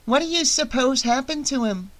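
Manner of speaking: put-on small, high child's voice; high-pitched little girl asking. A narrator's reading. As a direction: ask it like a curious little kid. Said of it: "the little one asked".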